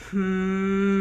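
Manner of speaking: long steady hum. Shown as a demonstration. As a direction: hum it out on the exhale. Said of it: "We can do a hum".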